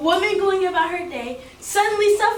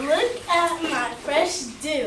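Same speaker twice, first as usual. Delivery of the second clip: slow proud line; 2 semitones lower, much slower than usual. Shown as a demonstration. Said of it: "start from the beginning".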